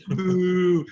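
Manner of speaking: mock boo. Playful heckle of a friend.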